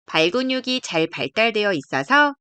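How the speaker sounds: usual speaking voice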